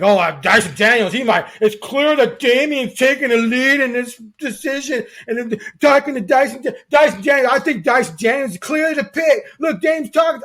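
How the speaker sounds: high, loud whiny fan impression; mock excited fans like a whiny kid; whiny eighth grader. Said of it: "sounds like a whiny eighth grader".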